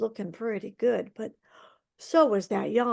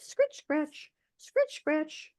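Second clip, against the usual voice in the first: raised, hushed scritch scratch, each falling; scratchy carding sound; 6 semitones higher, 4 dB quieter, much slower than usual. A narrator's reading. Teller mimics the old woman's carding, softly raised. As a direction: voice the wool cards scraping, high and soft.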